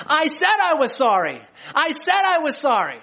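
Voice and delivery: put-on a kid insisting they already apologized; pleading child. Child chasing sibling to parents, high whiny protest. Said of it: "the child behind is on their tail saying".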